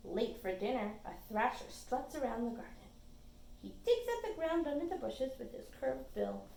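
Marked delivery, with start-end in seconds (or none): none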